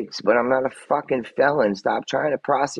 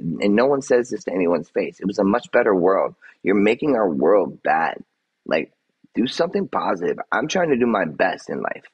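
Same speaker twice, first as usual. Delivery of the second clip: usual speaking voice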